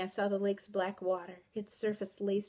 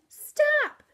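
very high, loud shriek; piercing command to halt; scream; 10 dB louder than usual. A narrator's reading. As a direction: scream it in panic. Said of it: "I screamed".

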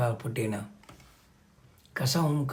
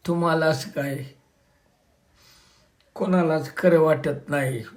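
heavy tearful voice; 3 semitones higher, 4 dB louder than usual. A narrator's reading. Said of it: "भरल्या डोळ्यानं जड आवाजात".

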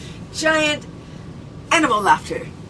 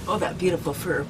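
ordinary delivery

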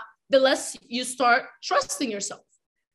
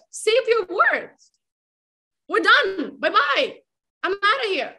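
higher, louder fed-up scolding; mock telling-off; 5 semitones higher, 4 dB louder than usual. Dumping a partner who never keeps his word, fed-up scolding. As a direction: tell him off, you're done with him.

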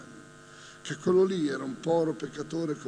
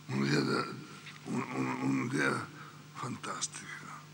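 much lower, whispered un'idea fantastica; awed whisper; 11 semitones lower, 9 dB quieter than usual. Acted. Preacher telling congregation of Christ's idea, hushed whisper. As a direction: breathe it out in wonder.

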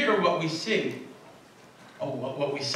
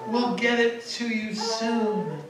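slow, higher breezy excuse; breezy students; 7 semitones higher, slower than usual. Retold or imitated. Late students to professor, slow breezy excuse. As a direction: brush it off like careless students.

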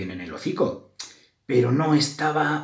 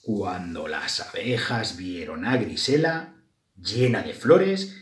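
ordinary delivery

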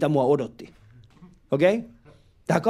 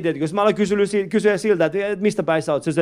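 ordinary delivery

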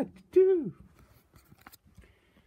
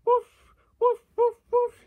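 high, louder pretend woofs; imitated dog bark; 12 semitones higher, 6 dB louder than usual. Barking like a dog between remarks, high imitated woofs.

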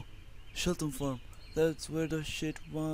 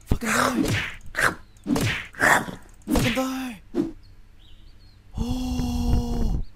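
louder, higher frantic shouts; frantic yelling; 10 semitones higher, 9 dB louder, slower than usual. Real, not acted. Gamer fighting off a zombie, yelling frantically. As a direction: scream it while swinging wildly.

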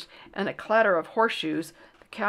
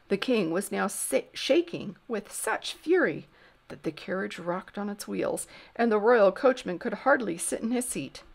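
ordinary delivery

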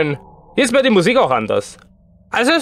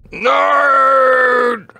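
high, drawn-out wail of no; long scream; 6 semitones higher than usual. Real, not acted. Player caught by Granny, wailing scream. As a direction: scream it on one held note.

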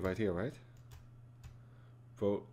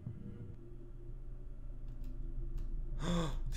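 long shocked gasp; 6 semitones higher, 9 dB quieter than usual. Real, not acted. Scare in horror game.

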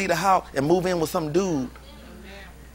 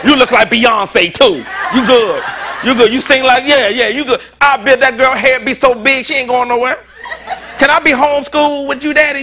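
excited praise; 5 semitones higher, 5 dB louder than usual. Real, not acted. Preacher shouting praise, laughing.

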